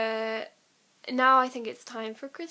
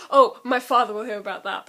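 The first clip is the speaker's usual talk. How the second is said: lightly voiced spoilt rich boy, a touch louder; haughty Scorpius voice; 10 dB louder than usual. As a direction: sneer it like a pampered heir. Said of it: "I was gonna say".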